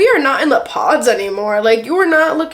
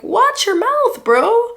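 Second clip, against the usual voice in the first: high scolding outburst; 13 semitones higher, 5 dB louder, much slower than usual. Pretend. Vlogger scolding Bartise on camera, high indignant yelp. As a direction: shoot up high and louder, telling him off.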